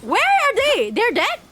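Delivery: usual speaking voice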